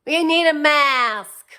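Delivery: high, loud, slow demand; mock Karen shout. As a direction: yell it like an outraged shopper. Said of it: "She's like".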